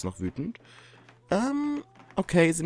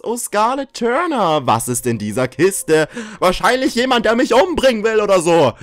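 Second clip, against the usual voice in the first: mock dread; 7 semitones higher, 7 dB louder than usual. Dramatic reading of fan mail.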